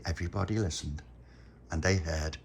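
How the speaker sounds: ordinary delivery, nothing marked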